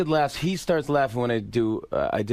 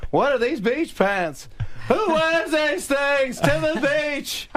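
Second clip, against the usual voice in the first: Jerry Seinfeld impression; slower than usual. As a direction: rant like Seinfeld, up high and slow. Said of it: "I did Seinfeld".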